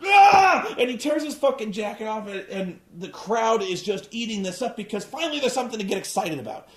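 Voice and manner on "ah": high screaming, voice cracking at the end; wild shouting. Retold or imitated. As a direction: tear into it, out of control. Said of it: "He's just".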